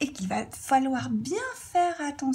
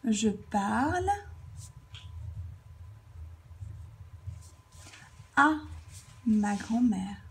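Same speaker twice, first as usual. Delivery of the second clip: very long pause after "parle", then "à" alone; broken-up dictation; 5 dB quieter than usual. Shown as a demonstration. Teacher writing the example out, dictating "Je parle... à... ma grand-mère" in pieces.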